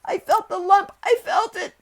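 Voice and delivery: very high, loud hysterical wail; choked panic. A narrator's reading. Sick boy convinced of a lump, choked panic. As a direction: cry it out as if choking on sobs. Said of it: "choked Colin".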